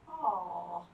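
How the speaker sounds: usual speaking voice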